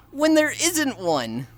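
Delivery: high, slow, drawn-out objection; exasperated. Real, not acted. Streamer disputing a chatter's joke claim, exasperated.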